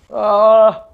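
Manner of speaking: loud, high yell of alarm; startled scream. Real, not acted. Player startled in the game, startled scream. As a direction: yelp out a sudden high "ah".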